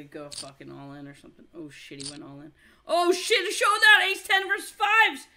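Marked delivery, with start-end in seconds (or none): 2.9-5.3 high, loud showdown yelling; excited yelling. Real, not acted. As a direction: yell at the big showdown. All-in showdown.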